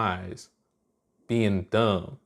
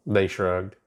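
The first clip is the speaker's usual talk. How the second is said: plain reading voice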